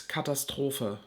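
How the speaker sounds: Katastrophe said the German way, ending in "-fuh"; German pronunciation. Shown as a demonstration. Vlogger showing the German word, "Katastrophe" in German pronunciation. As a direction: say "Katastrophe" as German, long O, soft final E. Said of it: "The German word for catastrophe is".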